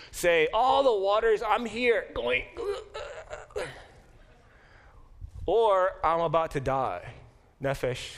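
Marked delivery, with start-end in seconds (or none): 0.5-7.0 mildly voiced Jonah. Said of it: "you can translate this more literally".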